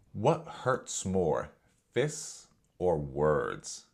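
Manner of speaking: drawn-out, very slow, weighty question; slow, loaded question. Retold or imitated. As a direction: drag it out as if setting a trap. Jimmy poses his loaded question.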